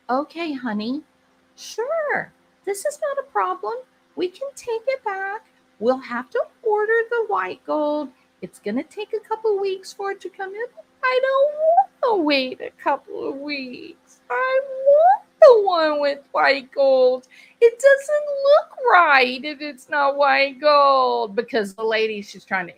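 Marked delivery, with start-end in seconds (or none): 0.1-10.7 very high, appeasing voice; over-obliging, pitched way up to keep the peace; placating husband. Acted. Husband placating whining wife. 11.0-21.3 loud, high, drawn-out wail; hammed-up bratty whine, high and loud; whiny tantrum. Whiny wife tantrum acted out.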